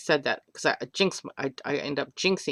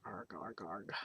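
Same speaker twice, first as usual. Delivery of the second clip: pirate growl; 7 semitones lower, 17 dB quieter than usual. Pretend. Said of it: "Make me talk like a pirate".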